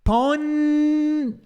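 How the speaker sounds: "pon-" high, tongue n, mouth open. Shown as a demonstration. Teacher showing the wrong n before b. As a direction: hold an n, not m. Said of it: "tentar fazer um N aqui".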